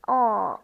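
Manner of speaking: O with rounded lips. Shown as a demonstration. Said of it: "look at the shape of my mouth again".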